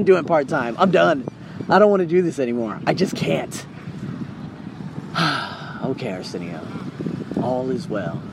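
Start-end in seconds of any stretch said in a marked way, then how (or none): 3.9-5.8 long, soft exhale; weary sigh. Real, not acted. Speaker giving up on part-time work, weary sigh. Resigned after saying he can't. 5.8-8.2 low, soft and subdued; quiet self-reassurance. Real, not acted. Speaker reassuring himself after a sigh, hushed low voice.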